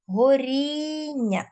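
горіння drawn out, stressed on the middle syllable -рін-; stress on the suffix. Shown as a demonstration. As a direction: stretch the stressed í in горіння, slowly. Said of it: "наголос на інь".

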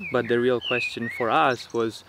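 ordinary delivery

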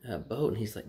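ordinary talk, nothing marked

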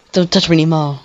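ordinary talk

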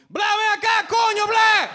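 sudden very high, loud garbled Spanish bellow; hammed-up full-force Spanish holler; yelling. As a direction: burst into dad's mock yell. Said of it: "the yelling in public".

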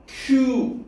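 '큐'를 높고 길게 끌어서; '큐'의 '유' 소리를 길게. Shown as a demonstration. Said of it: "유 발음을 하는 거야". ('큐' 하고 높게 시범)

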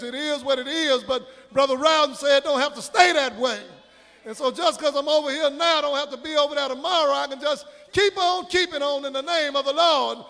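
ordinary talk, nothing marked

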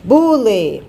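"Bule" drawn out, both syllables sounded fully; "Bule" said slowly as bu-le for a pupil; syllable demonstration. Shown as a demonstration. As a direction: stretch "Bule" so each syllable can be counted. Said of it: "Quantas vezes eu abro a boca".

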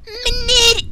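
luid en hoger, wanhopig schreeuwend; schreeuwen. Acted. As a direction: schreeuw hem bang na. Calling out loudly. (luid schreeuwen naar meneer)

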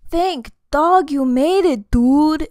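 loud, high, drawn-out exclamation; slow, booming storybook cheer; exclaiming. A narrator's reading. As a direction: greet a buddy with big relief. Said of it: "exclaimed Don Diego".

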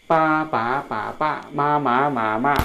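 ปา ป๋า ป๋า ป้า through level, rising, dipping, falling tones; four-tone drill. Shown as a demonstration. Teacher demonstrating tones to learners, four-tone drill. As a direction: chant ปา then มา through all four tones.

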